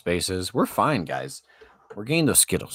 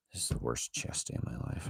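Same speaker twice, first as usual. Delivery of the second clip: whisper; 6 semitones lower, 12 dB quieter than usual. Real, not acted. Despairing aside, unvoiced.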